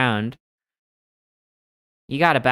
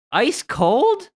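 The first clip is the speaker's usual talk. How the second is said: sudden much higher, louder question; surprised question; 12 semitones higher, 7 dB louder than usual. Real, not acted. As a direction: yelp it in disbelief. Surprised reading clue.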